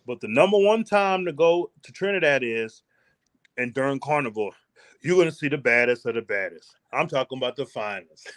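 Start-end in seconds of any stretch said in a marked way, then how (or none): none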